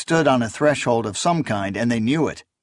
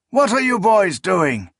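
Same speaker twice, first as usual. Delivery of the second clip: put-on higher loud old man's scold; old priest scolding; 8 semitones higher, 3 dB louder than usual. A narrator's reading. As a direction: demand it like an angry elder. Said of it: "a hoarse voice boomed".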